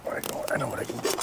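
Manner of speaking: quick excited whisper; whispered idea to himself. Real, not acted. Idea while cooking.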